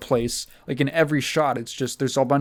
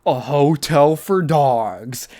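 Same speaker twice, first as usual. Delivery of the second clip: slow, louder movie-trailer boom; dramatic title announce; 6 dB louder, slower than usual. Retold or imitated. Friends watching the movie, booming the title like a trailer voice. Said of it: "we would be like".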